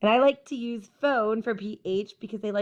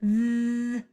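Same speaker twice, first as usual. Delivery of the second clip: chainsaw buzz with tongue between teeth; voiced th demo. Shown as a demonstration. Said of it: "turn your voice on like a chainsaw".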